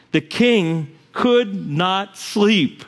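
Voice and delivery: "The king could not sleep" drawn out word by word, weight on could; very slow repeat. Shown as a demonstration. Preacher repeating it very slowly for the congregation.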